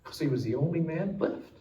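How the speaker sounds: preacher lowering his voice for the sacrifice; hushed solemn sermon close. Acted. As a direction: let it sink in quietly.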